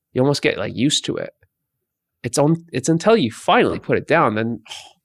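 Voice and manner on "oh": soft, breathy sigh of release; sigh of relief. Acted. Weight holder finally puts it down, relieved sigh. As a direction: let out a soft acted exhale of relief.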